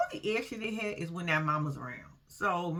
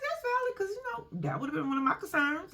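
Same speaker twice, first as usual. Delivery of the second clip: high, prim agreeable voice; 10 semitones higher than usual. Retold or imitated. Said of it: "and I was like".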